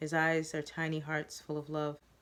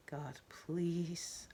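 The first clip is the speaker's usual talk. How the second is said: softer, murmured plea; quiet prayer; 6 dB quieter than usual. Acted. Narrator praying Joseph won't have a seizure, softly. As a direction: pray under your breath. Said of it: "I pray".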